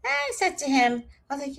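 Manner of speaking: usual speaking voice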